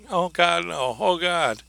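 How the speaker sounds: desperate pleading. Retold or imitated. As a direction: beg for life in terror. Shot girl pleading in recording.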